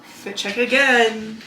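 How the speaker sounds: ordinary delivery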